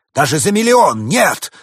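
high, loud indignant proclamation; angry. A narrator's reading. Winter spirit refuses to be bought, angry and loud. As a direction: thunder it out, stretching the last words. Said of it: "рассердился дух зимы".

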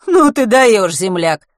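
loud, high-pitched amazement; astonished. A narrator's reading. Driver to a stranger who missed the news, astonished. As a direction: exclaim in loud disbelief at a clueless stranger. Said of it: "удивился водитель".

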